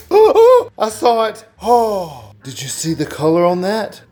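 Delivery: loud, high, thrilled gasps; excited gasp. Real, not acted. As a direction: shout out in delight at the first glimpse. First glimpse of printed color.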